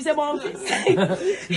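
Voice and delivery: very slow, laughing reveal; mother triumphantly revealing her son; proud reveal. Retold or imitated. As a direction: savour the punchline. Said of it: "Après, elle a dit".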